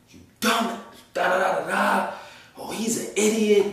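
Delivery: high, mocking cursing voices; mock angry passengers. Passengers cursing at him, high mock voices. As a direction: squeal out the insults like a jeering crowd. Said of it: "everybody all at once start cursing me out".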